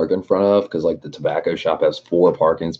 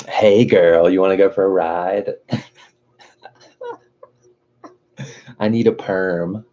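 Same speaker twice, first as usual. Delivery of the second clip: mock sassy voice; 2 semitones higher than usual.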